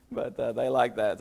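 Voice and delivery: ordinary talk, nothing marked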